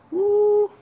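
high exhaled whoop while thinking back; high woo. Real, not acted. Coach reckoning how many years ago, high woo.

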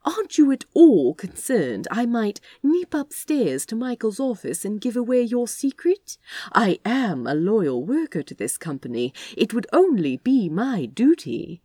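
high mock threat, half whispered; theatrical. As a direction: tease him like a stage villain. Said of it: "more theatrically than the situation called for".

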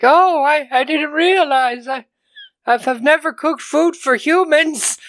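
imitating the wife apologising for the cat food; dotty wife. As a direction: play her as an oblivious cat lady, high and innocent. Retelling the wife's lines.

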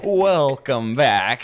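high, booming, drawn-out welcome; mock show welcome. Host greeting listeners after the break, big mock show welcome. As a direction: stretch it out loud like a show opener.

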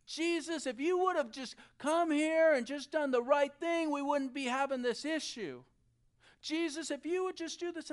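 high, loud acted reproach; upset pleading. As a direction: imitate an upset sister pleading, no real tears. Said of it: "she is crying. She's upset. She's like".

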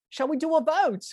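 high, put-on comeback; mock retort. Umpire answering the batsman back, mock retort.

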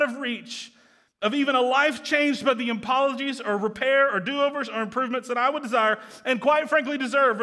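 loud, high, impassioned preaching; preaching at full force. Acted.

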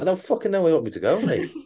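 high, fast, exasperated mock reply; retold exasperation. Passenger to kebab driver who keeps repeating the address, mock exasperated. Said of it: "they just stand there repeating it".

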